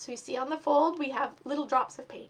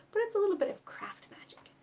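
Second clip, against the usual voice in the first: whispered, higher and playful; secret whisper; 8 semitones higher than usual. Acted. Teacher whispers to children about craft magic. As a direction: let the kids in on a secret.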